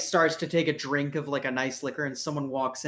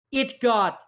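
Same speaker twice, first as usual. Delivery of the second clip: mocking quote; 9 semitones higher, 5 dB louder than usual. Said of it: "and I quote".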